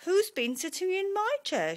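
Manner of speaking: high, loud, indignant Mummy Bear; story-time outraged mother bear. A narrator's reading. Mummy Bear finds chair used.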